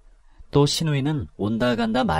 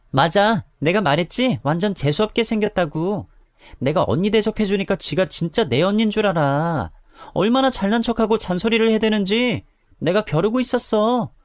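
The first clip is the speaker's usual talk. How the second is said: much higher, gloating contempt; spiteful mockery; 8 semitones higher than usual. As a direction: sneer to her thug friend. Sister-in-law badmouthing her. (깔보는 말투)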